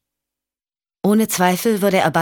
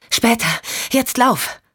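higher, hurried order; urgent command; 6 semitones higher than usual. Acted. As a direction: hurry the girl away. Michelle urges Vici to run.

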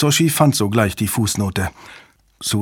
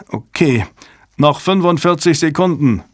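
urgent shouted countdown, higher; a tense shout warning that time is running out; 6 semitones higher, 3 dB louder than usual. A narrator's reading. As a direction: call it out across the room. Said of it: "schrie jemand".

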